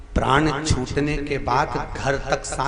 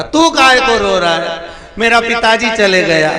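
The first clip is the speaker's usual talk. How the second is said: loud, higher hammed-up wail; mock crying son; 7 semitones higher, 9 dB louder than usual. Son wails to the guru over his father, hammed-up crying. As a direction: play the weeping son, overdone. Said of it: "बेटा रो रहा था".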